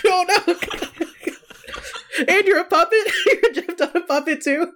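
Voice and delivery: squealing high through laughter, real; high laughing shriek of mock alarm; giggling high at a friend's bad British accent. Real, not acted. Laughing fake-shock at accent.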